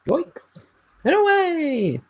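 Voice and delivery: very high, loud cartoon cry; cartoon character voice. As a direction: shout it like a cartoon hero taking off. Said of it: "cartoon character voice".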